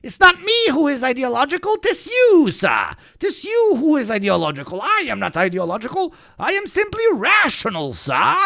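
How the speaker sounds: high, fast, snooty debater voice; mocking posh voice. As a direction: do a smug, pompous debater. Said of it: "this is the classic spin".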